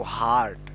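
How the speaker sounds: हार्ट with a long 'aa', pitch dropping low; 'आ' vowel. Shown as a demonstration. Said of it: "यहाँ पे आ उच्चारण". (हार्ट में लंबा 'आ')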